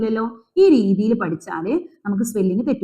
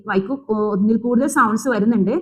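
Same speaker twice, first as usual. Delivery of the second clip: ordinary talk, nothing marked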